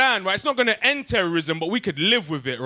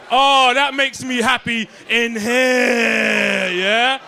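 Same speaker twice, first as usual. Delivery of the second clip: gleeful; 3 semitones higher, 6 dB louder, much slower than usual. Real, not acted. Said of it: "Oh my fucking God".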